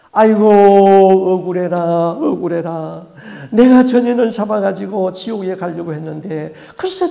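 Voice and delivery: the demon howling its grievance; wailing demon. Retold or imitated. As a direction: wail it out like a grieving spirit. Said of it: "막 울면서 통곡을 하는데".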